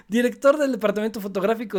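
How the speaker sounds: reading the title while still chuckling; laughing through it. Real, not acted. Lecturer to class, amused at own accent, laughing while reading.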